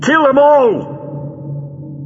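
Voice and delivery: very high, long-drawn scream; frenzied villain's screamed command. A narrator's reading. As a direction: shriek the order, dragging it out. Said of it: "he screamed".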